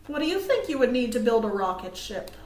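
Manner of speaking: ordinary delivery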